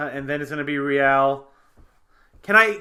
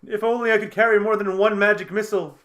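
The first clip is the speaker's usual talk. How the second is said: higher, louder hammed-up complaint; mock mage lament; 7 semitones higher, 5 dB louder than usual. Player speaks as the lamenting mage.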